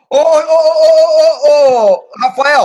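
long loud drawn-out calls; loud calling. Real, not acted. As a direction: call out over the noise. Shouting to mute someone.

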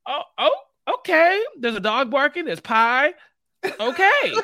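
high, loud bewildered okays at a weird scene; baffled surprise. Retold or imitated. Viewer stunned by a strange episode ending, high loud bewilderment. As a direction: react like the show lost you. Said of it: "And I said".

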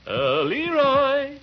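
long, loud call up the stairs, high; drawn-out loud high call; calling upstairs. Acted. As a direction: call out to the boy in his room. Uncle calls boy upstairs.

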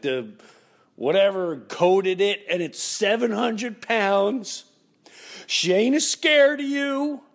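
slow, high, loud neighbor impression; smoker's voice. Neighbor describes the huge bear, smoker's voice. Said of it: "She had that smoker's deep voice".

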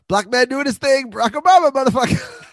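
loud, high-pitched imitation of a scene; exuberant shouted quote. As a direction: belt out the quoted line with gusto. Acts out favourite line from scene.

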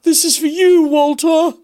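mocking Maid Marian's cheesy line, way up high; mock movie heroine. Mocking the film's awful Walter line, in a shrill put-on heroine voice. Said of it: "this awful bit in it where she literally goes".

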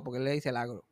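ordinary talk

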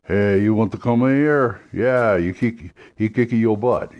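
throaty Cajun swamp accent. Shown as a demonstration. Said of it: "Cajun, because you got the".

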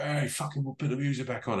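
high, strained mock yell with no real volume; retold crowd shout. Festival crowd demanding the music back, mock shouting. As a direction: imitate an angry heckler, held back and high. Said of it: "and really shouting".

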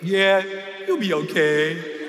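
low, slow, drawn-out reassurance; unbothered, spaced-out guy, pitched well down; laid-back stoned instructor. Retold or imitated. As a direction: sound like you're half out of it. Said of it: "I thought he was high".

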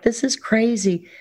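half-laughing awe at her own luck; amazed disbelief. Retold or imitated. On stage beside her hero, retelling her amazed disbelief. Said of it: "looking around like".

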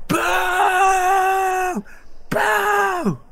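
very high, loud, stretched boos; long wailing boos; crowd booing. Acted. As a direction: jeer like a forming riot. Rioting crowd boos again.

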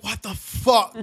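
high, stunned disbelief; shocked what the fuck. Retold or imitated. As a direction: gasp it out in shock. Shocked at the skirt orgy.

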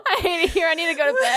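high whine through laughter; laughing despair. Real, not acted. Host defeated by a silly cow joke, laughing whine.

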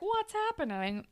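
high, soft, baffled squeak; mock bewilderment. Recapping boy doused in paint, mock bewildered.

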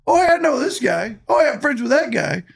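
high, bright "oh yeah I know this guy"; mimicking friendly greeting. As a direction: imitate him greeting everyone. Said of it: "everywhere we go, it's".

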